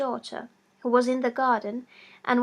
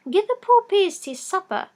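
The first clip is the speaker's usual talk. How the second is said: mother insisting; 8 semitones higher, 7 dB louder than usual. A narrator's reading. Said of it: "said the old woman".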